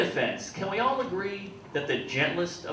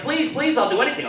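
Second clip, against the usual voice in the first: put-on begging girlfriend; 10 semitones higher, 5 dB louder than usual. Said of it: "She's like".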